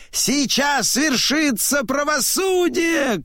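very high-pitched proclamation; high town-crier shout. A narrator's reading. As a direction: cry out the verdict high for all to hear. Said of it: "кричит он".

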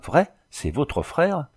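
high, eager question; happy, lifted pitch; joyful exclamation. A narrator's reading. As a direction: ask it with glad disbelief. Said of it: "s'écria le malade avec joie".

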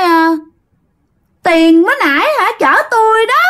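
high, eerie ghostly woman's voice. Acted. Ghost answering dad about the fare, high and eerie. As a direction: rise to a shrill, gleeful reveal. Ghost reveals herself to the driver.

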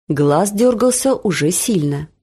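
ordinary delivery, nothing marked

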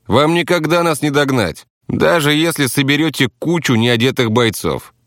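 insistent taxi driver. Acted. Taxi driver tells rivals they cannot catch up, insistent.

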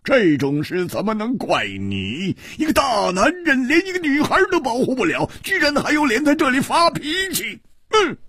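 high, loud tirade at himself; impassioned outburst, pitch soaring; angry self-reproach. Acted. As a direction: berate yourself at the top of your voice. Hero furiously berating himself.

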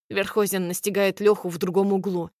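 ordinary delivery, nothing marked

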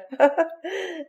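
high, put-on laugh; showy laugh. A narrator's reading. Conchita makes Mathéo listen to her laugh, showy high laughter. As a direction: laugh on purpose to taunt him. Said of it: "écoute comme je ris bien".

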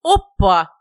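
loud, delighted whoop; loud exclamation. A narrator's reading. Friend spots the stain, loud exclamation. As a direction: exclaim it like a sudden discovery. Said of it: "воскликнул Родион".

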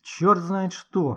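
slow, emphatic and loud; drawn-out disgruntled outburst; grumbling. A narrator's reading. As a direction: grumble in exasperation. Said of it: "проворчал Никита".

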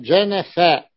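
جنفاء slowly as ja-na-fa; جنفاء, drawn out with three a vowels; all short a vowels. Shown as a demonstration. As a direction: spell out each open a in جنفاء. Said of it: "قال بفتحات".

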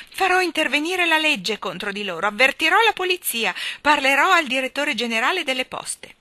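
high, loud threats; loud indignant shouting. A narrator's reading. Postman fleeing upside down downstairs, loud indignant shouting. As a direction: yell your threats as you flee. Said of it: "gridando forte".

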